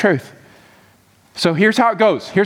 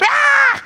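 high mock scream of fright; frightened cat scream. Preacher acting the cat surprised by a monkey, mock terrified scream. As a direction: shriek like a startled storybook cat. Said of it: "finds himself in the corner freaking out".

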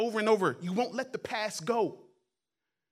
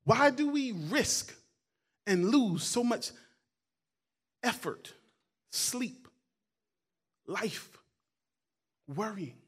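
slow fading question; slower than usual. Acted. Preacher slowly fading out his list of losses. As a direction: let the list die away slowly, word by word.

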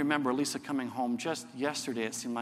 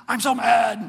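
loud, higher "I'm so mad"; mock angry teen; 6 semitones higher, 10 dB louder than usual. Pastor voicing his upset daughter, loud and higher.

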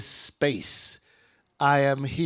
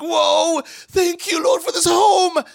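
high, loud thankful cry of joy; joyful shout; 13 semitones higher, 6 dB louder than usual. Acted. Believer thanking God for a new house, loud joyful shout.

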